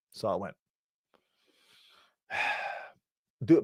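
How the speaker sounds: normal talking voice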